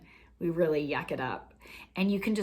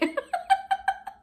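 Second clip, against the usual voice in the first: very high squealy giggle; high squeal of a laugh; 4 dB louder than usual. Real, not acted. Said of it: "sounds that way".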